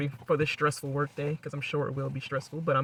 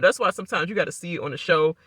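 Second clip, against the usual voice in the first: ordinary talk, nothing marked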